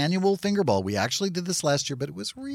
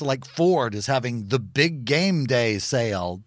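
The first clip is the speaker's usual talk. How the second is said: usual speaking voice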